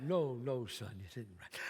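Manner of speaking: father hushing son, low and soft; low reassuring father. Acted. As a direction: reassure him quietly like a dad.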